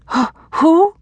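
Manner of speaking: high-pitched, urgent demand. A narrator's reading. Frightened woman demands the hidden man's name, high and insistent. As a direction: demand an answer, voice leaping up high. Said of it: "she demanded".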